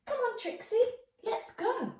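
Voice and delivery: put-on high eager little boy voice; child voice. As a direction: call the dog like an excited young boy. George voiced, child figure.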